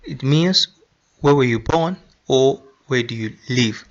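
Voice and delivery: ordinary talk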